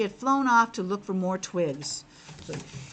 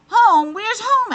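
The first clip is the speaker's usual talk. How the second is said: much louder, high surprised frog question; frog asking; 11 semitones higher, 12 dB louder, slower than usual. Acted. Frog demands to know where home is, loud and high. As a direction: bark out the question in disbelief. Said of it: "asked the frog".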